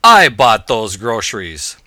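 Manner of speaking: loud, slow, emphatic rebuttal; gloating shout. A narrator's reading. Atheist jumping out of the hedges at her, shouting. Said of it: "jumped out of the hedges and shouted".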